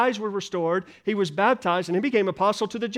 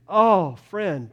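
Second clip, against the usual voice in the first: ordinary talk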